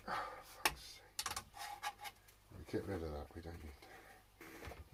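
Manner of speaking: normal talking voice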